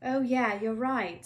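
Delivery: Ron voice. Acted. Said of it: "said Ron".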